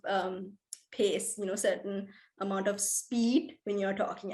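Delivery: normal talking voice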